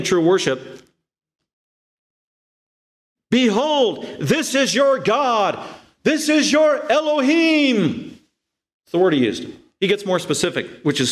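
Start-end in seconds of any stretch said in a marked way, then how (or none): 3.3-8.2 high, slow grand announcement; stretched-out ceremonial cry, pitched up; Aaron's proclamation. Retold or imitated. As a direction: proclaim it to the whole nation. Aaron proclaiming the idol to people.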